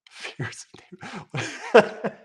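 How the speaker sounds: breathy spooky whisper breaking into a laugh; whispered parody of the phrase; mock whisper. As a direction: hush it like a ghost story. Whispering the article phrase mockingly.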